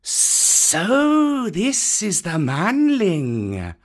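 put-on study the boy slowly; sizing-up python. A narrator's reading. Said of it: "said Kaa".

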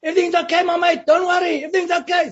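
shouting up from the soccer ground to a friend in a cell; distant shout. Retold or imitated. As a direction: yell it across the prison grounds. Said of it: "my Afrikaans mate shouting from the soccer ground".

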